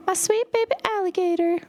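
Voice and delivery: put-on high cooing baby talk to a pet; cat voice. Shown as a demonstration. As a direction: coo at her like a kitten. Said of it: "in my cat voice".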